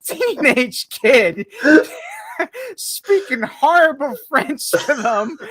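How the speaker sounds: words squeezed out through laughter; slow, high, loud giggling speech; laughing while talking. Real, not acted. He laughs while telling his friend about the prank calls. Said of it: "laughing through telling".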